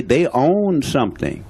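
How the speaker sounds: normal talking voice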